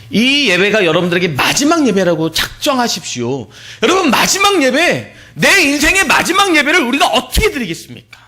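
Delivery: preacher crying out at full force; fervent preaching. Real, not acted. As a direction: lift the congregation with a high, fervent call. Preacher urging, climactic appeal.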